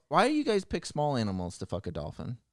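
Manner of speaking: ordinary talk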